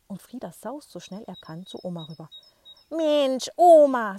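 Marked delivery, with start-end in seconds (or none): 2.9-4.2 loud, high, out-of-breath gasp; panting after the run; breathless exclamation. Acted. Boy arrives out of breath before grandma, loud and high. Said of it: "sagt er atemlos".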